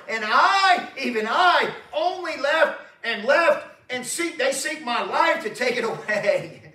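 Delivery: preacher hammering out the storm verses; full-force preaching. Acted. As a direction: thunder the verses at the congregation. Preaching Elijah's complaint at full force.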